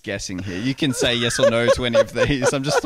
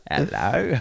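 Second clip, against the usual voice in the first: spooky seer greeting; fortune teller; 2 semitones higher than usual. Pretend. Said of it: "I'm a fortune teller".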